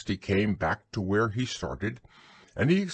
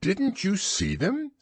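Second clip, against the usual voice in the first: high-pitched, mildly surprised question; high owl voice, slightly taken aback; a little surprised; 10 semitones higher than usual. A narrator's reading. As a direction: ask with mild surprise, pitched up. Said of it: "a little surprised".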